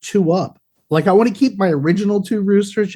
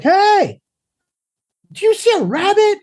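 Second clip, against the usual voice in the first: put-on much higher girlish call. Friend's girl calling out about the rabbit, high. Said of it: "she's like".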